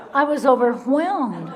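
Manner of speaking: laughing. Real, not acted. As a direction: laugh at your own naive younger self. Laughing at own naivety.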